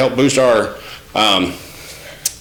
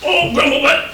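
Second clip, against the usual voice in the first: much higher, cooing pet name; cooing at puppy. Real, not acted. Owner cooing a pet name at her grumbling puppy, much higher.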